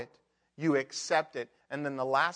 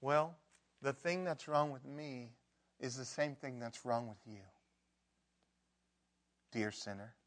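soft quiet gentle reply; tender humble answer; 2 semitones lower, 10 dB quieter than usual. Pretend. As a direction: say it gently, sinner to sinner. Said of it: "they could just gently say".

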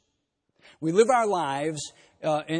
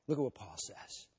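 ordinary talk, nothing marked

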